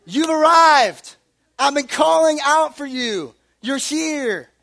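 put-on high loud joyful baby voice, slow on arrival; overjoyed little boy greeting dad; delighted toddler. Son greeting dad at the door, high joyful shout. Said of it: "and he's like".

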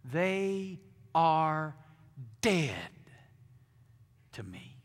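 loud, biting declaration; cold contempt. Pretend. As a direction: cut them off for good. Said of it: "You might even say out of your mouth".

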